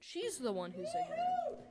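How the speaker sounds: squeaky high mocking voice; high mimicking voice. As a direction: parrot her in a high, silly pitch. Mocking the woman's words.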